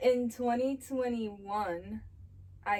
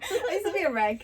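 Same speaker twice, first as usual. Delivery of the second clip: laughing; 8 semitones higher, 6 dB louder than usual. Real, not acted. Laughing confession between friends.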